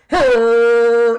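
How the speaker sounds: loud imitated horn blast into a phone; hammed-up horn noise; mock horn blast. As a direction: blare it like a horn right into the receiver. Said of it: "takes, like, a fucking horn".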